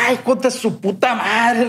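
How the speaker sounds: fed up and venting at the doctors; exasperated outburst. Retold or imitated.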